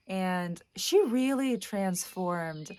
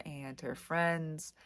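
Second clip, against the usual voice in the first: ordinary delivery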